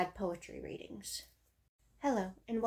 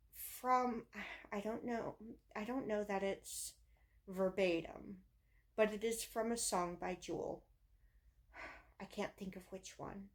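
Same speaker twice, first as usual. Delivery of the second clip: normal talking voice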